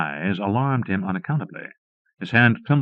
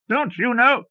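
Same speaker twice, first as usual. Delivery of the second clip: very high, furious scream; furious roar; 13 semitones higher than usual. A narrator's reading. Villain roaring at the lieutenant, furious high scream. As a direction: explode at him in rage. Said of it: "roared Cromley".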